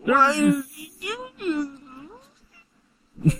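high whiny fake cry; mock crying voice. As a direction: sob it out, hammed-up. Mocking the photo face.

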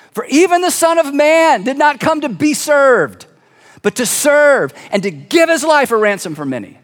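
high opening, settling as it ends; emphatic proclamation. Acted. Jesus speaking about himself to the twelve, proclaiming. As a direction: proclaim it boldly, then bring it home. Preacher proclaims the climactic verse.

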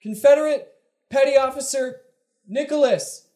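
desperate high distress call; high, panicked plea for rescue; running out of air. Acted. Distress message played on bridge.

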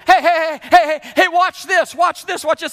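high, loud, repeated shouts for attention; insistent kid yelling. Retold or imitated. Grandkids demanding to be watched, loud insistent yells. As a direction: hammer the heys like an impatient child. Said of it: "And again and again".

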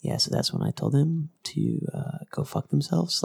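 soft, calm radio-host voice; NPR voice. Pretend. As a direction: read it like a mellow public radio host. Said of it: "like N P R ... very casual".

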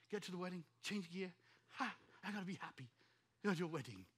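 ordinary talk

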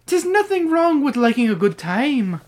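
high-pitched Irish lilt; put-on Irish accent. Mister O'Grady laughing off Eddie's insult, Irish accent. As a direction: say it in playful stage Irish. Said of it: "I like your Irish accent there".

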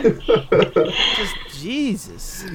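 slow, stretched-out "Just Jesus."; drawn-out awe. Real, not acted. Podcaster swooning over the actress, very slow. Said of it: "drawn out".

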